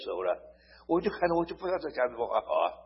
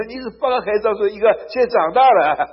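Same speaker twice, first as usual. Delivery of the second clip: higher, louder and fast, delighted; surprised recognition; 7 semitones higher, 10 dB louder, faster than usual. Retold or imitated. Preacher meeting the grown son, surprised and delighted. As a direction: burst out in glad surprise.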